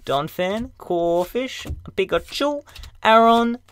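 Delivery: put-on high, squeaky kid whining. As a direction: whine like a let-down kid. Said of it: "I'd just be like".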